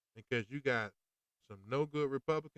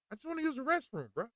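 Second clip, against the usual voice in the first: much higher, whiny plea; exasperated pleading; 13 semitones higher, faster than usual. Pretend. Man begging to use the restroom, squeaky and exasperated. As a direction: squeak it out in disbelief.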